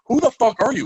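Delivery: high, loud stunned question; amazed. Retold or imitated. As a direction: gasp it out in disbelief. Amazed fan.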